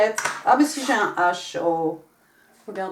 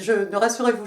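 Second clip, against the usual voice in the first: normal talking voice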